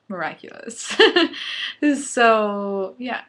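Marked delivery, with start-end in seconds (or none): 1.0-2.0 high loud laugh. Real, not acted. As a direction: break into a laugh. Laughs after saying miraculous.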